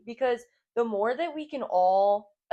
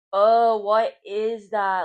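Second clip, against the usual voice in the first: annoyed groan; 7 dB louder, slower than usual. Retold or imitated. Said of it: "it was actually making me mad".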